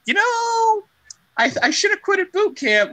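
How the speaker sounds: high, whining regret voice; mock whiny soldier. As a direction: whine it like a quitter. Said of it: "and you're like".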